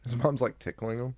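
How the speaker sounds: ordinary talk, nothing marked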